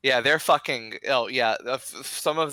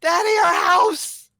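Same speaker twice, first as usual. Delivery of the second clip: put-on very high, crying child voice; child's voice; 6 dB louder than usual. Child to father as their house burns, high panicked wail. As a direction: wail it like a frightened little kid. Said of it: "you hear a child's voice".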